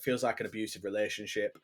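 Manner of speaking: ordinary talk, nothing marked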